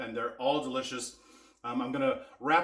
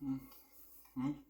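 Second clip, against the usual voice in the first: satisfied mmm; 10 semitones higher, 7 dB quieter than usual. Real, not acted. First bite of the food.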